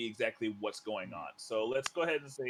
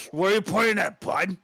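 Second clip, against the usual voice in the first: raised, loud orc demanding an answer; 6 semitones higher, 8 dB louder than usual. Acted. Game master as orc questioning the pointing player, demanding. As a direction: challenge them as a loud, puzzled orc. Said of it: "and then goes".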